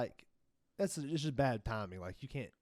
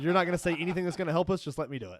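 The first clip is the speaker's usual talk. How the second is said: impatient retort; 6 semitones higher, 7 dB louder, faster than usual. Retold or imitated. Retold shutting down apprentice.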